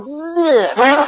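high comic "bleh" noise; imitated blowfly retching. Blowfly reacts to the foul junkie house, mock retching. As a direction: make a mock gag of disgust. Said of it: "A blowfly would have gone".

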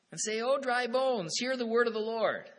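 preaching loudly to the dry bones; loud proclamation. Retold or imitated. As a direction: call it out like a prophet to the valley. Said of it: "He says to me, "Prophesy to these bones. Preach to 'em".

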